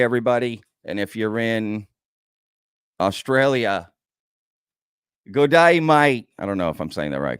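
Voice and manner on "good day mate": a clumsy mock Australian greeting, louder and higher; playful Aussie impression, hammed up and badly done; bad Australian accent. Greeting Australian viewers on the stream, bad mock Australian accent. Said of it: "God, my accents are horrible".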